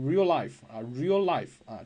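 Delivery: real life with the two L sounds merged into one, slowly; linked real life. Shown as a demonstration. Teacher demonstrating real life as one merged L. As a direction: run real life together as one smooth L. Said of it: "就发成".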